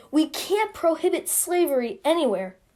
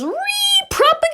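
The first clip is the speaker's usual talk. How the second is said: very high, loud comic shriek; outraged screech; 5 dB louder than usual. Acted. The South reacts to the book, outraged screech. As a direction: screech it out, stretching the last syllable.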